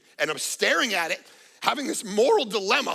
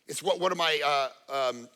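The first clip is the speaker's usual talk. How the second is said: ordinary delivery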